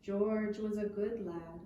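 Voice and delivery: ordinary delivery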